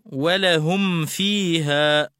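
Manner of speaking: ولهم فيها very slowly, a clean short m before f; clear meem, unexaggerated. Shown as a demonstration. Teacher shows students ولهم with a clear, unexaggerated m. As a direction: articulate the m of ولهم without overdoing it. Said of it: "من دون مبالغة".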